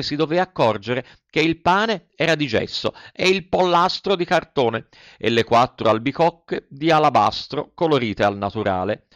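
usual speaking voice

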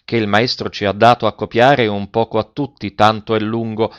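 ordinary delivery, nothing marked

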